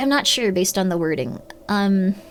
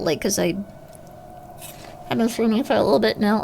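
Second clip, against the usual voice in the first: ordinary delivery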